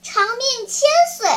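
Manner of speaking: high, loud, piping well-wish; ringing high-pitched blessing; puppet's cheery blessing. Acted. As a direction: call it out high like a little puppet. Puppet blesses the sneezer again.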